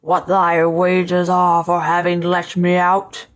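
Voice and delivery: slow, menacing giant spirit; awful spirit voice. A narrator's reading. Freed spirit towers over the scholar, awful voice. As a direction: draw it out in a dreadful monster voice. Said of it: "he cried in an awful voice".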